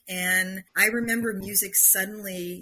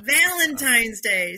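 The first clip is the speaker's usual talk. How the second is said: "Valentine's Day" loud and drawn out; emphatic repetition; 3 semitones higher, 7 dB louder, much slower than usual. Real, not acted. Driving home the date to the listener, loud, slow "Valentine's Day". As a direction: hammer "Valentine's Day" slowly, syllable by syllable.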